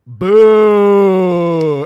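loud drawn-out mock boo; crowd booing. Comic imitating the crowd booing him, loud. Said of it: "the whole crowd goes".

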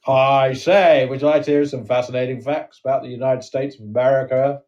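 lightly hammed-up American host accent; a hint of a cheesy American presenter. Asking Sam about American facts, light American accent. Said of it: "we're doing accents again".

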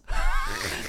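real high, breathy laugh; sudden giggle after the punchline; burst of laughter. Real, not acted. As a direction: crack up at your own impression. Laughs at own impression.

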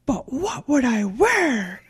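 slow, swooping movie-character quote; hammed-up, stretched-out question; Grinch quote. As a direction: draw it out theatrically, pitch sweeping up and down. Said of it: "and then he goes".

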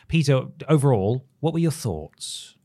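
usual speaking voice

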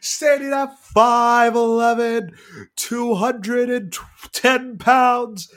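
big booming ring announcer, drawn out; boxing announcer intro. Pretend. Co-host announcing the actor like a boxer, loud and booming. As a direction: hype him up like a prizefighter walking out.

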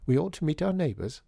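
chirruping sister. A narrator's reading. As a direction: pipe it up with a slight chirrup. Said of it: "chirruping, chiming voices of the Misses Plaisance".

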